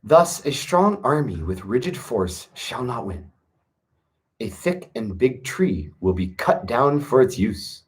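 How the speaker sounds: scripture reading. Acted. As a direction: read each line like sacred text, with pauses. Reads scripture aloud.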